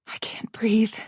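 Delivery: gasping, unvoiced, can't get air; choked whisper. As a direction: whisper as if being strangled. Choking panic in nightmare.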